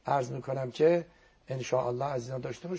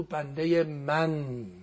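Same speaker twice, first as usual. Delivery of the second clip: slow reverent quote; much slower than usual. Retold or imitated. God's words, drawn out.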